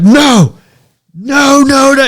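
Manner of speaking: very high, loud panicked wail; terrified no. Retold or imitated. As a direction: wail no over and over. Terrified guy refusing.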